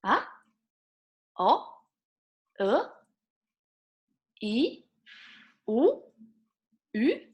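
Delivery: "Ah, o, e, i, u, ü" each rising like a question; second tone. Shown as a demonstration. Teacher drills six vowels, each rising like a question. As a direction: swoop each short vowel sharply upward. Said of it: "second tone is like question".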